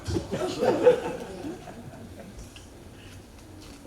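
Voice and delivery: breathless high laugh; cracking up at his own joke; high wheezing laugh. Real, not acted.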